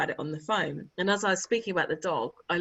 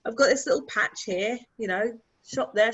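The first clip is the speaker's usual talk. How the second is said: ordinary talk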